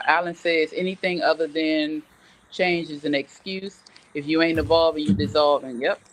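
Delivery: ordinary talk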